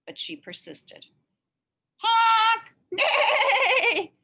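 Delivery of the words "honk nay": much higher, loud honk then horse neigh; hammed-up street noises. A narrator's reading. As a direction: blare the horn and whinny like a horse.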